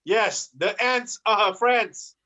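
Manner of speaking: ordinary delivery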